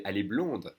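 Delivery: blonde closed with an audible D; pronounced D. Shown as a demonstration. Teacher modelling the form for a girl, pronounced D. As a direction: sound the D at the end of blonde. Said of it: "you pronounce the D".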